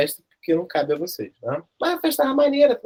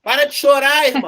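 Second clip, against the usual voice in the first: higher, louder scolding yell; retold yell; 7 semitones higher, 6 dB louder than usual. Teacher acting out yelling at the weeping king, loud. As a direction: snap the crying king out of it. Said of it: "Pô".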